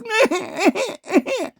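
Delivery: a squeaky burst of laughter; high laugh. Real, not acted. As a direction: crack up at the joke. Laughing at his own parody.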